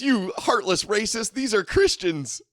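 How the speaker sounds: high-pitched, whining mock outrage; exaggerated high voice of mock protest. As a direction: wail it as a hammed-up offended zealot. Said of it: "He goes".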